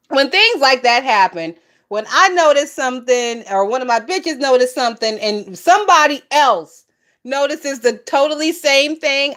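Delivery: normal talking voice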